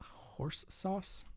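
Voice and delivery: whispered question. Real, not acted. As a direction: breathe it out quietly. Asks about the joke.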